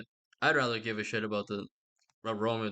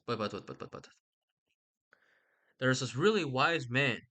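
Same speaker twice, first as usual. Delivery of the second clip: ordinary talk, nothing marked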